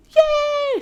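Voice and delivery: very high cartoon cheer; high-pitched cheer; squeaky cheer. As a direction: squeal with joy.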